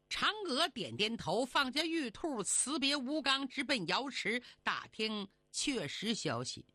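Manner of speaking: plain reading voice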